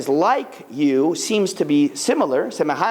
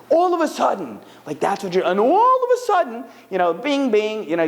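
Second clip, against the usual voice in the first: mock excitement; 5 semitones higher than usual. Speaker mocks a surprise windfall, hammed-up excitement. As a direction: voice leaps high on all of a sudden, then bing, bing. Money suddenly arriving, mock wonder.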